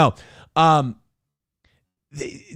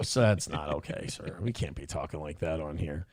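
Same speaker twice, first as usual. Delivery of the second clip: much lower and much softer, primly polite; mock prim quiet reprimand; 12 semitones lower, 13 dB quieter than usual. Radio host quietly scolding a swearing caller, prim. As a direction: hush the caller with fake politeness. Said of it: "I would just go".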